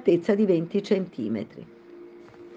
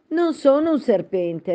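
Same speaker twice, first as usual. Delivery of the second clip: higher, offended protest; indignant; 8 semitones higher than usual. A narrator's reading. Alice denies to the pigeon she is a serpent, indignant. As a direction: push back, insulted. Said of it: "rispose Alice indignata".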